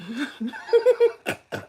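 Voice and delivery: high wheezing giggle; helpless high laughter; real laughing fit. Real, not acted. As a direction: try and fail to stop laughing. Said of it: "I'm crying".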